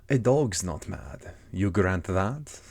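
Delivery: slow, drawn-out cat voice; Cheshire Cat. Acted. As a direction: stretch the words out like a leisurely storybook cat. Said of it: "said the cat".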